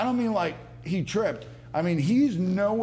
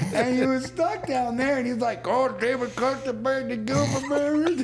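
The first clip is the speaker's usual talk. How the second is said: put-on West Virginia accent; 10 semitones higher than usual. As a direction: ham up a West Virginia cousin stuck in the grass. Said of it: "That was your West Virginia accent right there?".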